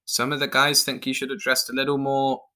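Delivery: plain reading voice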